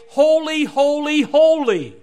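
high, loud cry on each "holy"; exultant proclamation. Acted. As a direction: proclaim every "holy" as worship, at full voice. Angels' worship, answering rejected alternatives.

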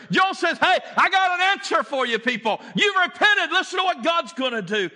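high, loud fervent preaching; full-force preaching. Acted. Preacher to the congregation, fervent loud preaching. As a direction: drive Joel's call home to the church.

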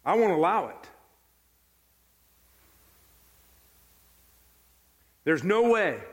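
emphatic, separated statements of refusal; firm refusal. Acted. Peter refusing Jesus the foot washing, firm. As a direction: refuse outright, with long pauses between. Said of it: "He said".

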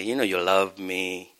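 slow, low, tender coaxing; God nuzzling close. Acted. God nuzzling up to a believer, low tender coaxing. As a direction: coax gently in a low, drawn-out voice. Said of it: "he'll nuzzle over to you and say".

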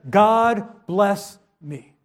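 GOD, BLESS, ME, each word slow and set apart; emphasized plea. Acted. Jabez calling on God, slowly emphasized. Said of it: "it was emphasized".

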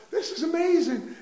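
high, breathless wonder at the pardon; amazed servant. Retold or imitated. The forgiven servant marvelling at his luck, high and amazed. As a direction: gasp it out like he can't believe his luck.

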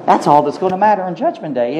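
ordinary talk, nothing marked

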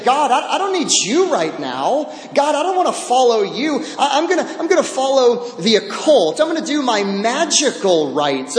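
defiant mock rebel. Preacher voicing rebellious Israel.

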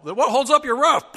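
high, loud gleeful yell; shouted punchline. Retold or imitated. Prank caller shouting punchline at the victim, loud high yell. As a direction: blast the joke line.

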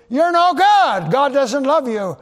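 loud, high voice of accusation; accusing lies. Acted. Lying spirit accusing a believer, loud and taunting. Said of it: "when lies come to you".

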